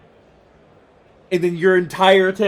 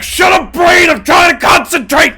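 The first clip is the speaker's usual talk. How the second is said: high, loud shout at your own brain; exasperated yell; 11 semitones higher, 10 dB louder than usual. Acted. Acts out yelling at own brain.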